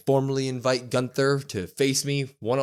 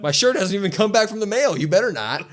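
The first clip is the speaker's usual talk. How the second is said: mock protest; 9 semitones higher, 4 dB louder, faster than usual. Fans mock-arguing with Roman.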